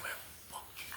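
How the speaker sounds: hushed, breathy fuck you; whispered curse. Retold or imitated. Cursing the neighbor through the door, whispered. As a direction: mouth it at him quietly. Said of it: "every time I see him".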